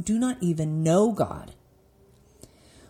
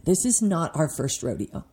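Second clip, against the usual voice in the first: ordinary delivery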